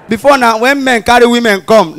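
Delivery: ordinary talk, nothing marked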